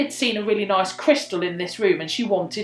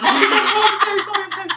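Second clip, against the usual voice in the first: very high, laughing imitation of apologising; panicky mock apology; 12 semitones higher than usual. Medium apologising to the angry friend, high and flustered. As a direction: squeal the apology through laughter.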